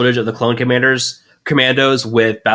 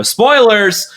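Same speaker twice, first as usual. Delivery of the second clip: a sudden jokey warning cry, pitched way up; playful spoiler shout; 10 semitones higher, 4 dB louder than usual. Real, not acted. Co-host jokingly warns listeners of spoilers, excited shout. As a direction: blurt it out, high and bright.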